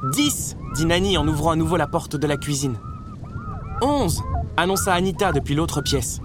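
high, breathless tally of puppies; excited counting. A narrator's reading. Nani counting newborn puppies for Roger, excited.

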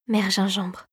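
soft, hushed dread; fearful realisation. A narrator's reading. Clara understands who towers over her, soft and fearful. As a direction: say it softly, with dread. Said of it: "la peur au ventre".

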